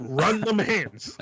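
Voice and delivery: slow, laughing through a threat; laughing tough challenge. Shown as a demonstration. Friend repeating the slang line with a laugh. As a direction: drawl it out then crack up. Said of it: "repeated demo with laugh".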